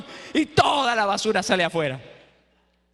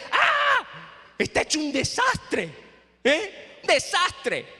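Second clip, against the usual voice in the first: panicked gasp; 7 semitones higher, much slower than usual. Acted. Wife panics at the messy house.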